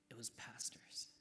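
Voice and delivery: unvoiced whisper; hushed dramatic reveal. A narrator's reading. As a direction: whisper the twist like a secret. Reveal in vision story.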